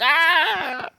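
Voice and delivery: long high cry; hammed-up scream of a stabbing victim; mock scream. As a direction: wail out a pretend death cry. Acting out being stabbed.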